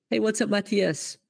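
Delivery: usual speaking voice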